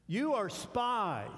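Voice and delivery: slow, loud, high accusing snap; stern accusation. Acted. Joseph to his brothers, loud slow accusing voice. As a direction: Joseph thunders the charge at them.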